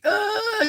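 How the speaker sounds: high crying "ugh", acted out; demonstrated breakdown groan. Shown as a demonstration. Showing viewers how grief breakdowns feel, mock sobbing groan. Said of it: "your whole body's shaking".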